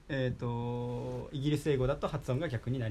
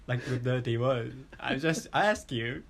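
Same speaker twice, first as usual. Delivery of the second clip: usual speaking voice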